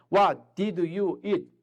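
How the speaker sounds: very slow, word by word; slow teacher model sentence. Shown as a demonstration. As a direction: drill it slowly. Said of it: "너 저녁에 뭐 먹었니?".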